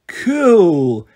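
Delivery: drawn-out, wowed exclamation; awed breath. A narrator's reading. Oliver admires the dolphin tattoo, breathed in awe. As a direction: breathe it out in boyish wonder. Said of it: "breathed Oliver".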